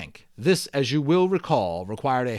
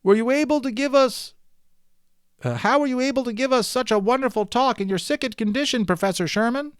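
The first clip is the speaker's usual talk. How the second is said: high, raised voice calling from the crowd; shouted question; 10 semitones higher than usual. A narrator's reading. Man in audience shouting up at the professor, high and raised. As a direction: call out over the crowd. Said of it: "a man in the audience shouted".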